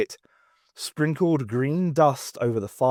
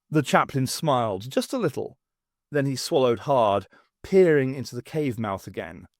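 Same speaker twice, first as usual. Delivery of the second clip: plain reading voice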